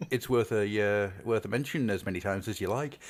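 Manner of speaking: usual speaking voice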